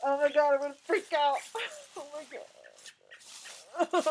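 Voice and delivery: high, panicky voice with nervous laughter; real panic. Real, not acted. Woman dreading the bird she must handle, panicky. About to freak out near bird.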